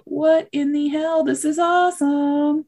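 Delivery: high, drawn-out astonished delight; surprised. Acted. Rabbit spots the cute little tar baby, surprised. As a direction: stretch it out in amazed wonder. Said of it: "He's surprised".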